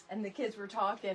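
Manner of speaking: normal talking voice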